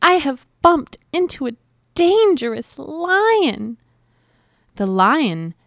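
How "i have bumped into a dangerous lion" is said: high little mouse voice, dismayed; high-pitched storybook mouse in alarm; alarmed mouse cry. A narrator's reading. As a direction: cry it out as a tiny, worried mouse. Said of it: "cried the little mouse".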